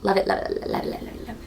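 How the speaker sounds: fading to a whisper. Real, not acted. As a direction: trail off whispering it over and over. Fading repeated gushing.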